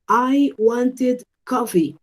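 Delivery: slow model sentence, WANTED as "want-id"; ID ending. Shown as a demonstration. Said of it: "Entonces yo diría wanted".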